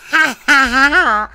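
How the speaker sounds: loud, helpless real laughter through the words; laughing. Real, not acted. Reader amused by their own silly voice, really laughing. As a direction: crack up while talking.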